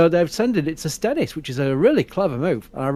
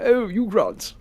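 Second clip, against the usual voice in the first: higher-pitched put-on English accent; mock English accent; 5 semitones higher, slower than usual. Mocking Americans' idea of English speech, exaggerated English accent. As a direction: imitate a caricatured English swoon. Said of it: "Nobody talks like that".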